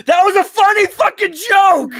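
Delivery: loudly fake-applaud your own joke; sarcastic shout. Real, not acted. Mock praising own joke.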